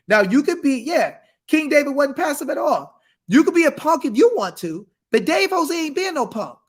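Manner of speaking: usual speaking voice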